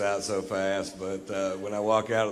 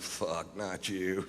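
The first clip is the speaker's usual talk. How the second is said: muttered dread; 4 semitones lower, 8 dB quieter, much slower than usual. Retold or imitated. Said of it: "And I'm like".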